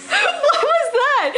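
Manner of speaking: high, slow, through laughter; cracking up while asking; laughing. Real, not acted. Friend laughing at his fall.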